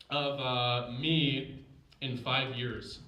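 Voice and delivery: ordinary talk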